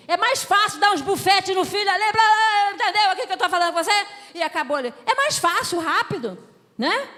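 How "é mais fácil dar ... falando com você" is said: louder, higher scolding blast; a hard-hitting sermon shout; preaching shout. Real, not acted. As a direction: hammer the point home at full volume. Preacher scolding lazy discipline.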